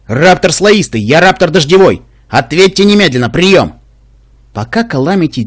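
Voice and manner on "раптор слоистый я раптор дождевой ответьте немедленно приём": loud radio call, pushing hard; громкий радиовызов. Acted. Вызов по радио. (громко, как по рации)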